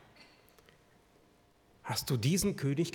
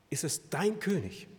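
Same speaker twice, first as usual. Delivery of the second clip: normal talking voice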